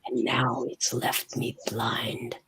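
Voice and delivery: very soft, slow whisper. A narrator's reading. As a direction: let the verse fade into a slow whisper. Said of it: "you hear a whispering".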